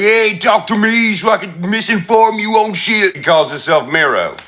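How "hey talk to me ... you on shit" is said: higher mocking imitation of a shady guy; lightly voiced sneering stranger. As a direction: mimic him as a smug liar. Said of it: "He's like".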